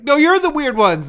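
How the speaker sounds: high, loud indignant comeback; flustered mock outburst. Mocking Mando's reply to the others, flustered. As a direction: act out someone who can't handle it. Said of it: "he can't even handle it".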